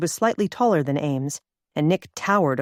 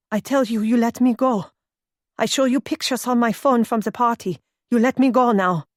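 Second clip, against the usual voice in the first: put-on German accent; 5 semitones higher than usual. A narrator's reading. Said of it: "Her accent made her sound German".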